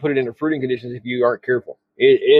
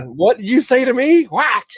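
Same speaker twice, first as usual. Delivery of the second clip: mock tough guy; 9 semitones higher than usual. Acting out slapping the bag.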